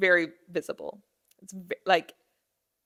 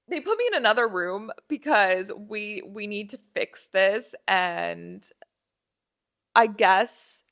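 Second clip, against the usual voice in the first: ordinary talk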